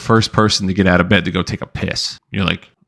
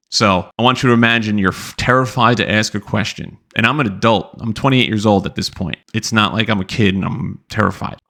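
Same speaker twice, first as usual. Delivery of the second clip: ordinary talk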